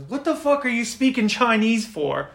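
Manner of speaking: high, loud indignant question; acted irritated bully; angry challenge. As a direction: snap it out in annoyed disbelief. Hostile person challenges Shion angrily.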